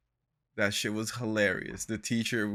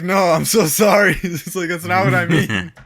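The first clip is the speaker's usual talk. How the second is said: high, loud words breaking with laughter; laughing apology; 8 semitones higher, 12 dB louder than usual. Retold or imitated. Retelling an apology to an offended partner, laughing. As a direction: apologize loudly through helpless laughter.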